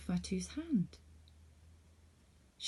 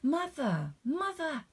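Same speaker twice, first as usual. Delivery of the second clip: high, louder excited cry; 9 semitones higher, 5 dB louder, slower than usual. A narrator's reading. Fatu runs home to her mother, excited cry. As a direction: burst in with the news. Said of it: "Fatu cried excitedly".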